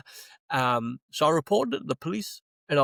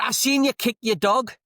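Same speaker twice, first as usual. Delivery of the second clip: much higher, louder put-on woman's voice; hammed-up outraged stranger; mock accusing woman; 10 semitones higher, 5 dB louder than usual. Woman accusing him of kicking his dog, in a mock high, louder voice. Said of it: "She went".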